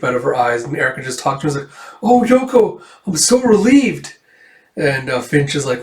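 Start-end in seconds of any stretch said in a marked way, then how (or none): 2.0-4.1 high, loud gush of relief; hammed-up relieved character voice; acted relief. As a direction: cry out high with relief, drawing out the end.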